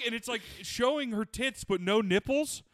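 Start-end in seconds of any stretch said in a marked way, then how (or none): none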